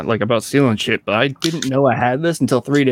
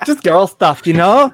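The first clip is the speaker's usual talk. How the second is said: put-on higher Valley Girl with rising end; Valley Girl accent; 5 semitones higher, 3 dB louder, slower than usual. Co-host gossiping about girl stuff, Valley Girl accent. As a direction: play a chatty Valley Girl. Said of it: "what's your best Valley Girl".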